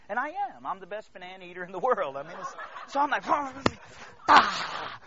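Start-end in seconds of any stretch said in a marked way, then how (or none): 3.3-5.0 loud comic "ah" with mouth crammed; mock frantic gobbling cry. Blindfolded contestant gobbling bananas, comically frantic.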